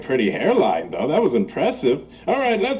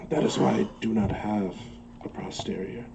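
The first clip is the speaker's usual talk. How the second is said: hushed confession; 4 semitones lower, 7 dB quieter than usual. Acted. Confiding embarrassing secret.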